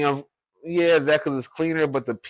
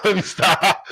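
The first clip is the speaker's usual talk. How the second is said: laughing; 4 semitones higher, slower than usual. Real, not acted. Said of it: "That's a little inside joke".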